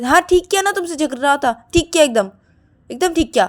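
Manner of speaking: loud, high self-justification; defiant retort. Acted. Shruti defends fighting with Siya, defiant. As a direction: insist you did right.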